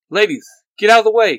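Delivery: loud, high, quick call; urgent warning. A narrator's reading. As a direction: clear them out of danger fast. Warning teammates during a fight.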